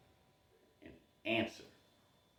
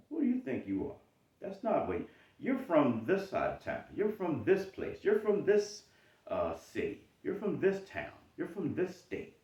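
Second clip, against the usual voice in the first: high, mocking taunts; scornful inner critic; 9 semitones higher than usual. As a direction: sneer at where they come from. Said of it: "imposter syndrome gives you those questions".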